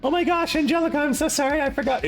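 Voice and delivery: high, rushed apology; flustered mock apology. Tenant caught out by property manager, high flustered apology. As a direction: gush out a hammed-up sorry.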